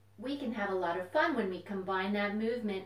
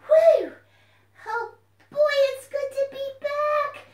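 high, loud, excited puppet voice; a bouncy little puppet popping up; puppet fox voice; 11 dB louder, slower than usual. Acted. Puppet fox greets the children, high and loud.

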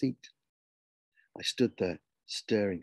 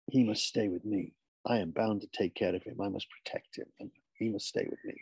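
put-on solemn, unyielding boy; 2 semitones lower than usual. Boy refusing to let his brother go, solemn and firm.

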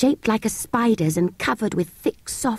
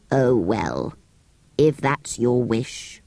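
much lower, slow grudging voice; grumpy sand fairy; 7 semitones lower, much slower than usual. Acted. Sand fairy agrees to come out, low grudging voice. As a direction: concede with bored reluctance.

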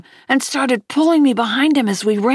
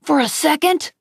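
ordinary delivery

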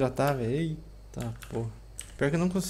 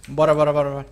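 loud urgent bora bora; being chased; 6 dB louder, faster than usual. Real, not acted. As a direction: hurry your friend out loud. Fleeing an attacker.

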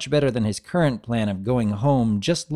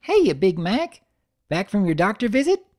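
put-on high, eager little-girl voice; little sister greeting her big brother brightly; young filly voice; 9 semitones higher than usual. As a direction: bounce it up high like an excited kid. Narrator voices Apple Bloom.

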